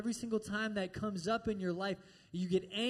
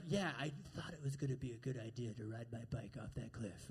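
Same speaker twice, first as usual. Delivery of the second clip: low, quiet mock teenage boy; 8 semitones lower, 7 dB quieter than usual. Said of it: "any teenage boy in here".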